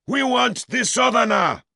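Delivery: a crowd baying for him; screaming mob. Acted. Crowd chanting again.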